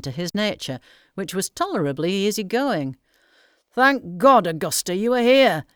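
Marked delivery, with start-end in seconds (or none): 3.7-5.6 louder and higher, bursting with relief; excited relieved cry; relieved exclamation. A narrator's reading. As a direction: blurt it out the moment you see her. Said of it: "he exclaimed".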